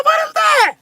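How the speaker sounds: very high alarmed squeak; high-pitched comic yelp; startled. Retold or imitated. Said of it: "I heard".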